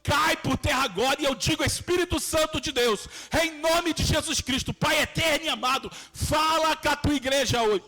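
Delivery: prayer building to a high-pitched, forceful climax; impassioned deliverance prayer, pitch climbing steadily; fervent commanding prayer. Real, not acted. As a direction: declare it with mounting urgency, rebuking evil.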